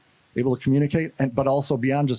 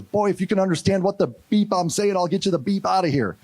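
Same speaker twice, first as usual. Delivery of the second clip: higher-pitched gruff-talking sergeant, retold; salty veteran sergeant; 7 semitones higher than usual. Vietnam vet sergeant to jailed boy, salty promise. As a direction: imitate a salty old sergeant's order. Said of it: "salty Vietnam vet sergeant".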